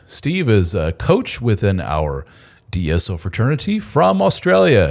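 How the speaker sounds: ordinary talk, nothing marked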